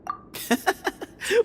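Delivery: a short, bright laugh at a silly question; laughing off a naive question; amused laugh. Acted.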